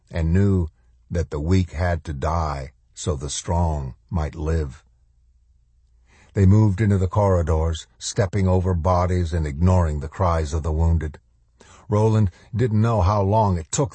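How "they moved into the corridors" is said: usual speaking voice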